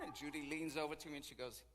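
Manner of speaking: normal talking voice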